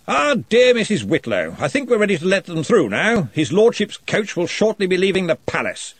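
jovial lordly wizard; booming Arch-Chancellor. A narrator's reading. Arch-Chancellor voiced in story.

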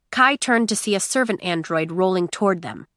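ordinary delivery

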